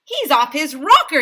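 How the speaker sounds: loud, high outraged yell; teacher yelling in outrage. A narrator's reading. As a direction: shout the protest. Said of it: "yelled Mister Docker".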